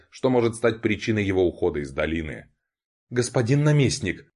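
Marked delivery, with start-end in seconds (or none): 3.1-4.2 hammed-up stage cry, higher; theatrical. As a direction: overplay it like a stage actor. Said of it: "театрально воскликнула".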